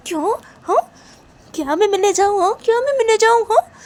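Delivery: high, loud challenging questions; indignant. Acted. Friend told to go meet the girl, high and indignant. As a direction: fire back in outraged disbelief, high and loud.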